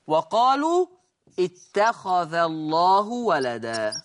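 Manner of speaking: slow, melodic Quran recitation; slow tajweed recital of the verse. Shown as a demonstration. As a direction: chant the verse slowly and evenly. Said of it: "الآية اللي بتقول".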